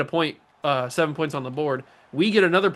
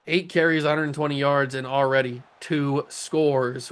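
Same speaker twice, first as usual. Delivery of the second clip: ordinary talk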